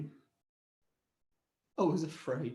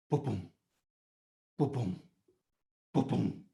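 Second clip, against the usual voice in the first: low soft thudding boom, boom; soft heartbeat boom; 11 semitones lower, 6 dB quieter than usual. Shown as a demonstration. Father at ultrasound, soft low heartbeat. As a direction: tap out a heartbeat.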